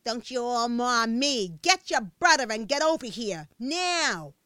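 loud, slow stern scolding; scolding mother. A narrator's reading. Mother scolding Pasquale to come home, loud stern scold. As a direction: lay down the law, loud.

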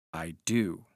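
low-pitched, tightly restrained reply; suppressed rage. A narrator's reading. Narrator answering Hawberk's question about Wilde, suppressing rage. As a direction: hold the anger in. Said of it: "suppressing the rage within".

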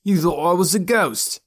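put-on high, teasing boy's voice; high-pitched gleeful taunt; gloating. A narrator's reading. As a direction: gloat over your trick, pitched high. Boy gloats over his trick.

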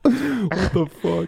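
shocked. Real, not acted. Shocked reaction to a gross description.

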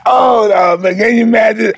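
high, loud laughing cry of disbelief; laughing outburst. Real, not acted. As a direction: burst out laughing, half shouting. Laughing disbelief at own story.

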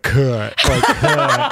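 "Kurt" gulped out with no R; imitating a friend's burpy "Kurt"; burp-like Kurt. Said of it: "it was like a burp".

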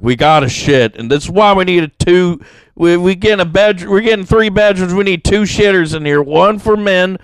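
high, loud hammed-up rant; mock yelling at girlfriend. Boyfriend yelling at girlfriend about bathrooms, high and loud. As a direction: yell it like a fussy boyfriend. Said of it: "yell at your girlfriend and be like".

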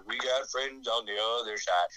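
a lightly voiced sly villain; Shadow Man. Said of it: "the Shadow Man's like".

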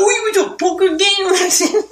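eager, high-pitched host impression; excited radio host. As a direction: gush like a clueless old radio host. Mocking radio hosts' excitement.

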